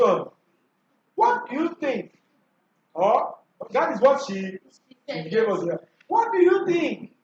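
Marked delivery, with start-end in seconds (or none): 1.1-2.1 "What do you think" as a high-pitched question; rising-tone demonstration. Shown as a demonstration. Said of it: "Rising tone". 6.1-7.1 "What do you think" pitched high as a question; rising-tone demonstration. Shown as a demonstration. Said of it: "Rising tone".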